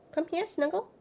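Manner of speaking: high sing-song coaxing of the dog. Real, not acted. Owner coaxing her dog high and soft.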